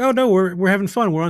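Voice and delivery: mock cheerful crew. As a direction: parody crew members insisting they're having fun. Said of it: "they just like".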